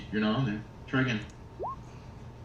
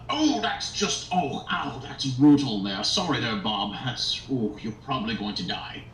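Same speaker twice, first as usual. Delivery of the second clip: lich voice. Acted. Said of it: "says the, uh, the lich nearby".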